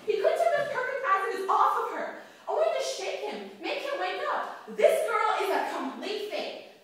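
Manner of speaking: high, loud exasperated rant; jealous outburst. Acted. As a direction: vent jealousy, slowing to hammer the accusation. Jealous outburst about her rival.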